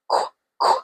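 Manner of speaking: "qu, qu" as two short voiceless kwuh bursts; Q sound. Shown as a demonstration. Teacher clearing up confusing Q, Q sound. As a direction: sound out "qu" alone, twice. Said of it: "It is actually".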